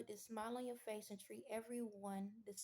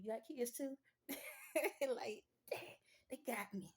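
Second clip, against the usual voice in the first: laughing along. Retold or imitated. Bonding with customer, laughing.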